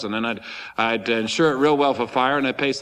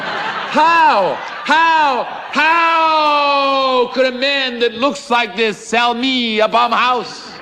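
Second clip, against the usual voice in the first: much higher, louder, slow drawn-out cry of disbelief; incredulous outburst; 10 semitones higher, 7 dB louder, much slower than usual. Retold or imitated. Buyer silently fuming at the man who sold him a bum house, hammed-up shout. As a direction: stretch each word out in comic outrage. Outraged thought about the seller.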